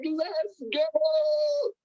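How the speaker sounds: high, drawn-out hype yell, imitated; stretched, high-pitched cheer; going crazy. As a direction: mimic a mentor going wild on the phone. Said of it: "on the phone going crazy".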